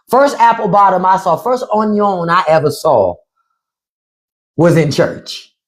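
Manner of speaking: ordinary talk, nothing marked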